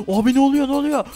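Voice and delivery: high-pitched startled outcry; gasp, then cry out in playful panic; alarmed. Pretend. Gamer to friend as the ride starts, alarmed.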